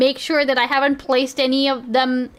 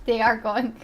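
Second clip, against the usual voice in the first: ordinary talk, nothing marked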